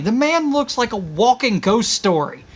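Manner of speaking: ordinary delivery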